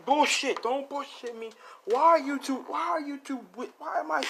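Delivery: very high, frantic squawking; confused panic. Retold or imitated. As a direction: stumble over your questions in high mock panic. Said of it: "he's confused right now".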